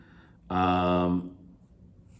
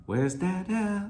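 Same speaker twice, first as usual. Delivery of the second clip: slow, high lilt on "Where's Dada"; sing-song; 10 semitones higher than usual. Shown as a demonstration. Teacher showing caretaker speech to students, sing-song. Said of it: "sounds like a song".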